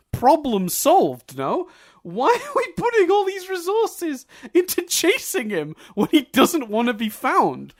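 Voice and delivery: high, loud, slow exasperated rant; incredulous shout. Real, not acted. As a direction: yell at the army in disbelief. Ranting at the army.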